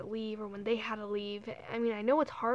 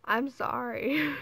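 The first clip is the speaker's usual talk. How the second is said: slow, earnest sorry, louder; a heartfelt, drawn-out apology; mean it, slowly; sincere slow apology; 2 semitones higher, 6 dB louder, slower than usual. Real, not acted. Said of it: "if this made you cry, I'm sorry".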